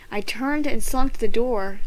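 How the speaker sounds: usual speaking voice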